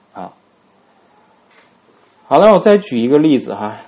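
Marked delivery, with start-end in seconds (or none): none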